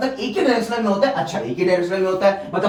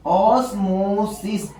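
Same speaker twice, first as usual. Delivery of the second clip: Osmosis drawn out slowly, each syllable clear; teacher stretches osmosis for students to remember; slow emphasis on term; much slower than usual. Shown as a demonstration. Teacher naming the key term for students, slow and clear. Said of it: "क्या कहते हैं".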